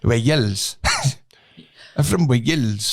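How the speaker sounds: slow, stretched mock Welsh; exaggerated Welsh lilt on the country name; Welsh accent. Trying the hard accent for mates, drawn-out Welsh accent. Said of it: "the Welsh accent".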